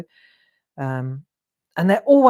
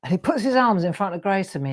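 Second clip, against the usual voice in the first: ordinary talk, nothing marked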